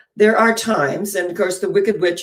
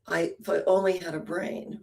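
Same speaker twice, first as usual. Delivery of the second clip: self-pitying scarecrow; 8 dB quieter than usual. Retold or imitated. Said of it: "putting himself down".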